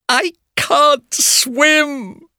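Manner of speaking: high, drawn-out tearful wail; sad wail. A narrator's reading. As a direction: wail it slowly, very high, nearly crying. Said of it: "she said sadly".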